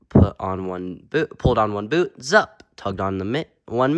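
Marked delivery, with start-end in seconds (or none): none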